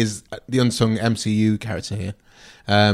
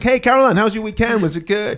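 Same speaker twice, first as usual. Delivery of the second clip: put-on high-pitched cheery greeting in a botched Baltimore accent; attempted Baltimore accent; 11 semitones higher than usual. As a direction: greet a favourite colleague in a bad Baltimore accent. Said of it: "Don't know what accent that is".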